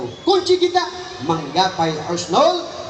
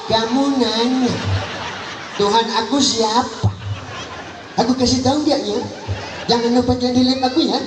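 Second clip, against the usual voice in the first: higher, laughing mock-cheeky answer; cheeky dead man; 7 semitones higher than usual. Dead man mocks the angel's question, laughing and cheeky. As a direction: taunt the angel like a live streamer. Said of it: "Ditanya sama malaikat".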